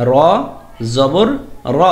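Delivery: র জবর র said heavy, full and rounded toward o; thick heavy র; heavy letter. Shown as a demonstration. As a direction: give র a thick, heavy sound. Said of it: "এটাকে মোটা করে বলতে হবে".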